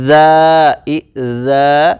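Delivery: ذا held long, soft th as in "this"; soft dhaa. Shown as a demonstration. Teacher reads the example ذا, softly articulated, tongue out. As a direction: let the tongue tip out gently on ذا. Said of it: "اس کو نرمی سے پڑھ رہے ہیں".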